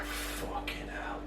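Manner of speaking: slow, very soft whisper; drawn-out whispered swear; retold stunned whisper. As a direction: breathe it out slowly, imitating disbelief. Acts out dad seeing the hoard.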